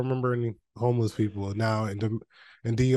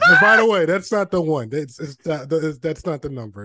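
very high and loud, cracking up mid-line; mock alarm at a restaurant bill, laughing hard; laughing retold panic; 6 semitones higher, 9 dB louder than usual. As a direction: squeal it out while laughing at the price. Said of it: "I was like".

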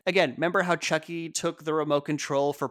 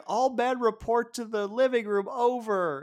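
high, urgent imitation of a radio summons; pitched-up mock dispatch; walkie-talkie call; 7 semitones higher than usual. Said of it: "she grabs the walkie from Stu".

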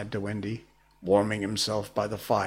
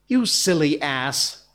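slow, louder, higher indignant cry; passionate cry; 5 semitones higher, 6 dB louder than usual. A narrator's reading. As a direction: fling the insult in a passion. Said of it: "cried Tinker Bell in a passion".

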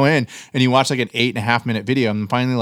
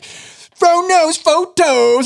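high, loud, very stretched-out catchphrase; imitated host's shout; 6 dB louder, much slower than usual. Fan imitating a YouTuber shouting his intro, over the top. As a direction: bellow the channel intro, dragging every syllable. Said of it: "who's this guy who's shouting?".

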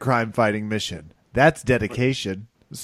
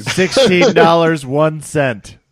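slow, louder game-show bid; Price is Right bid; 5 semitones higher, 7 dB louder, slower than usual. Pretend. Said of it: "[name]'s basically doing a Price is Right".

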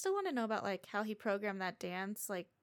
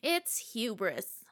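slow dramatic; 4 dB louder, much slower than usual. Pretend. Slow mock-grave verdict.

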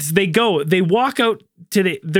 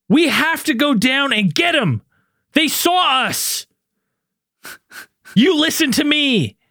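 high, slow, stilted line reading; mock-urgent, over-deliberate film quote; mock movie acting; 4 semitones higher, slower than usual. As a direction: draw each word out like a bad actor. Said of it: "Who talks like that?".

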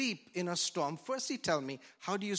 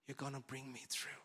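soft breathy plea to Jesus; whispered prayer; 4 semitones lower, 13 dB quieter, faster than usual. Shown as a demonstration. Preacher modelling a whispered prayer to Jesus. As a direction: pray it under your breath. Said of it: "with the whisper of faith".

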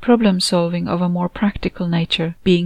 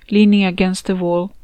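ordinary delivery

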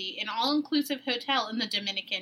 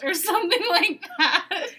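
laughing; 7 semitones higher, 5 dB louder than usual. Real, not acted. Retelling a funny salesman quote.

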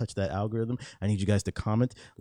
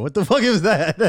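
laughing disbelief; 8 semitones higher, 10 dB louder than usual. Real, not acted. Reacts to friend's sexy voice.

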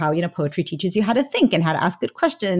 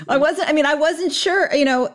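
a real laugh breaks into the words; 8 semitones higher than usual. Real, not acted. Host praises her answer, she laughs while replying. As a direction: laugh it off, caught by surprise.

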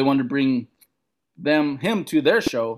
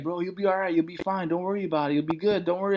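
soft, slightly raised retold voice; reassuring; 3 semitones higher, 5 dB quieter, faster than usual. Fellow comedian to nervous first-timer, softly reassuring. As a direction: brush off a friend's fears softly.

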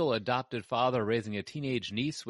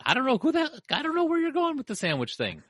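exasperated; 6 dB louder, faster than usual. Real, not acted. Exasperated at sandwich joke.

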